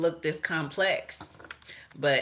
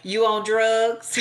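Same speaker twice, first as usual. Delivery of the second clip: slow, higher, louder mock mama asking; mama's suspicious question; 5 semitones higher, 6 dB louder, slower than usual. Mother quizzing her kid at the door, slow mock suspicion. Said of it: "every time I came in the door".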